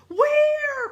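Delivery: very high, loud yelp; alarmed cry. A narrator's reading. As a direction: shriek the question out in alarm. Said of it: "cried Annie".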